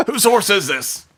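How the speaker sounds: high, whinnying horse voice. Acted. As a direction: talk like an indignant horse. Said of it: "That's my horse voice".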